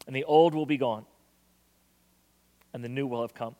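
measured prayer voice. Acted. Pastor praying over the congregation, measured. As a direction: pray over the room.

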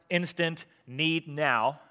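ordinary delivery

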